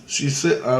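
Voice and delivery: usual speaking voice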